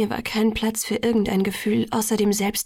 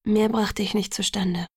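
ordinary delivery

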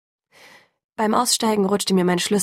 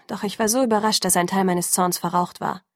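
ordinary delivery, nothing marked

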